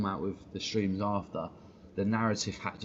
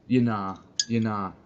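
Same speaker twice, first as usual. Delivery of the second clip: mocking slang; 5 dB louder, slower than usual. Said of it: "too old to be saying that word".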